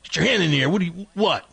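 high-pitched, incredulous interrogation; acted robber's challenge. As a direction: pounce on the one witness as the robber. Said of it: "and the guy goes".

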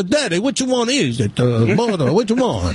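hammed-up Italian accent with swooping tune. Caller's son asking Daddy which one, mock Italian accent. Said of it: "in your Italian voice".

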